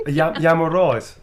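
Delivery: loud, broad Birmingham greeting; punchy Yam Yam Brummie; Brummie dialect. Shown as a demonstration. Teaching a local greeting in broad Brummie. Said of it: "Yam all right means".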